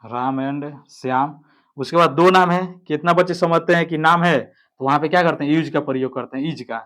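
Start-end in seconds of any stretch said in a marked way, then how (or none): 0.0-1.4 slow dictation. Shown as a demonstration. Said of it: "तो राम यहाँ पे हो जाएगा आपका राम".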